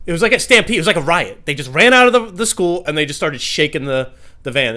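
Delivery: loud, high, building panic; shouting the chaos of the stampede; excited yelling. Retold or imitated. Comic telling the crowd about kids rocking his van, loud and high.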